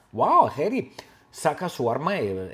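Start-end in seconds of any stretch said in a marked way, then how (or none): none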